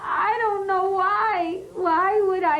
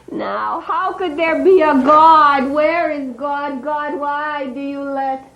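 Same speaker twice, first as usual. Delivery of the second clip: loud, despairing cry to the heavens; caged animal crying out against God; anguished outcry; 7 dB louder than usual. Acted. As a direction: hurl the question at God through tears.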